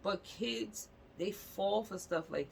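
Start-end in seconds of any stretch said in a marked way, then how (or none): none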